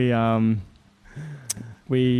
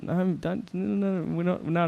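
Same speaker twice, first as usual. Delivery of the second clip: higher, hushed, stumbling string of no's; head them off in a soft fluster; flustered refusal; 6 semitones higher, 5 dB quieter than usual. Retold or imitated. Flustered, heading off her request.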